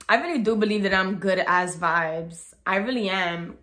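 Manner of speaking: usual speaking voice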